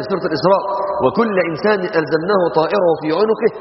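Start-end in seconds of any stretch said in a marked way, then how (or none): 1.0-3.5 melodic recited verse; Quran recitation. Acted. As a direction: recite it with a long tajweed line.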